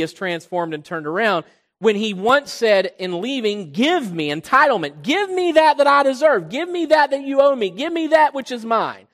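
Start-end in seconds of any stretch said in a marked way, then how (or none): 3.7-6.5 loud, high, arrogant demand; a son loudly demanding his inheritance. Acted. Prodigal son demanding from father. 6.5-7.7 loud, high, entitled demand; the son loudly claiming what's owed; arrogant demand. Acted. Prodigal son demanding from father. 7.7-9.1 demanding son. Acted. Prodigal son demanding from father.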